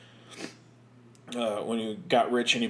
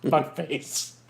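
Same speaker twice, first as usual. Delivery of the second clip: Malkovich impression; 3 dB quieter than usual. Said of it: "was it Malkovich?".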